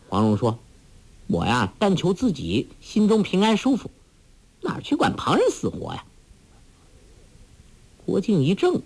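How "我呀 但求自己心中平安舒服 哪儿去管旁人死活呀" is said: put-on very high, quick willful girl's tone; a hint of a headstrong young woman. A narrator's reading. Huang Rong answering Guo Jing, high and willful.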